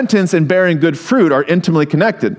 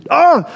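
short, high cry of exasperation; exasperated groan; 11 semitones higher than usual. Acted. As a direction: let out an annoyed "ah!". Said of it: "it annoys me".

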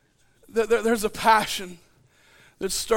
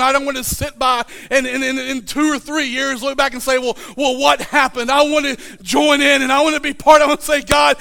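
louder, building appeal; rising preaching; 4 semitones higher, 6 dB louder than usual. Real, not acted. Pastor urging church to join what God is doing. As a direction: lift the room into commitment. Pastor rises again in sermon.

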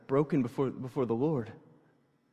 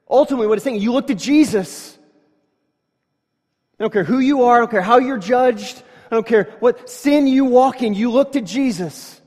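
loud, high impassioned preaching; preaching at full force; 8 semitones higher, 9 dB louder than usual. Real, not acted. Preacher urging his listeners to look to Jesus, loud and fervent. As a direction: drive it home to the congregation, loud and high.

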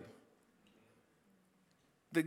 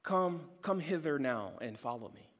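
soft, low reading without emphasis; understated reading; 6 semitones lower, 9 dB quieter than usual. Shown as a demonstration. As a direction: read it mildly, as if just reciting a verse. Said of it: "we read it and it just says".

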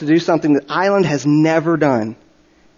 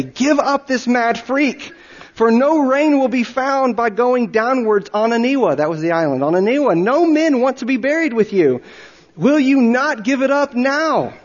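pleading villagers; 8 semitones higher than usual. Acted.

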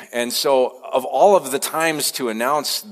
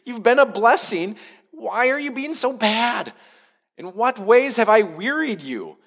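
much higher, wounded questioning God voice; pleading God; 10 semitones higher than usual. Retold or imitated. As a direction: voice God as a hurt parent asking why. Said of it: "the next thing that God says is".

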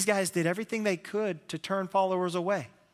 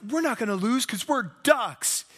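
inspiring coach; 6 semitones higher, 3 dB louder than usual. Retold or imitated.

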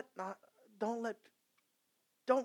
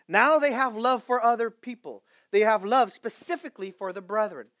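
full-force preaching; 5 semitones higher, 14 dB louder than usual. Acted. Pastor crying out to his congregation, loud and high. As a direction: preach it to the rafters.

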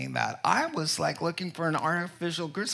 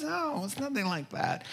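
high, soft, whispery reply; imitated wife in a small high voice; tearful wife; 9 semitones higher, 6 dB quieter than usual. Crying wife brushing off his guesses, high and soft. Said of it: "she started crying".

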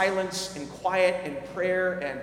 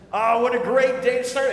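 louder, higher, overly upbeat stranger; chatty stranger; 5 semitones higher, 7 dB louder than usual. Retold or imitated. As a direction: sell the morning like a cheerful intruder. Stranger voiced in story.

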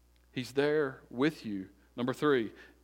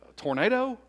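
acted double-take, higher voice; puzzled query; 9 semitones higher than usual. Father retelling his query to his son, puzzled and high. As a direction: pitch jumps up in a retold question. Father checks son's mistaken word.

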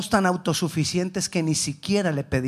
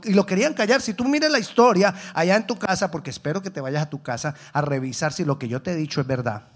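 ordinary delivery

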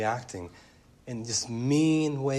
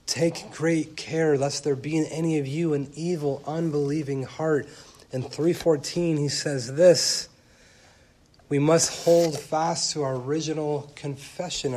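emphatic; 5 semitones higher, 7 dB louder than usual. Acted. Reading warnings from Hebrews.

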